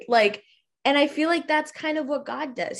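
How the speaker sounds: normal talking voice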